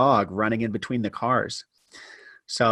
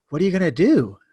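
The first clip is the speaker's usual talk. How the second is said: friend's question; 5 semitones higher, faster than usual. Retold or imitated. Friend asks after seeing this.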